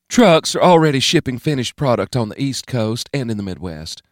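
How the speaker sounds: a hint of a Southern governor reporting; calm report to the President; Whitaker briefing. A narrator's reading. Narrator voices Whitaker reporting.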